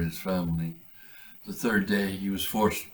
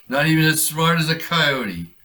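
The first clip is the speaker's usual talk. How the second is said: higher, louder scornful jab; smug gloat; 8 semitones higher, 8 dB louder than usual. Acted. Brian gloating over the man's failed trap. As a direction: savor the small victory.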